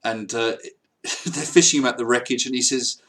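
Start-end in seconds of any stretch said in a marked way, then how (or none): none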